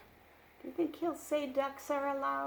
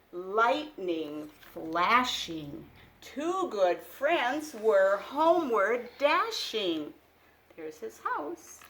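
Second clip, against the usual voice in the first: put-on louder, lively read-aloud for small children; picture book reading; 7 dB louder, slower than usual. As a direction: read it like a bedtime storybook.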